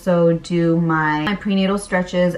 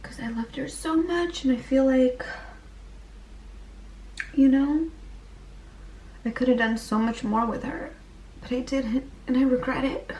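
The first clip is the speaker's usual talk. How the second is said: tearful, much softer and higher, voice breaking; real grief for her grandma, half-sobbed; crying; 7 semitones higher, 9 dB quieter than usual. Real, not acted. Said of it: "It's really emotional".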